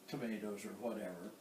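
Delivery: ordinary talk, nothing marked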